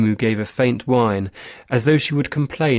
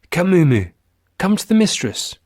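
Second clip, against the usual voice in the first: high, eager coaxing voices; coaxing companions; 8 semitones higher than usual. Acted. Companions echo the lady, coaxing Mumu high. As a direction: lure the dog in, higher and bright.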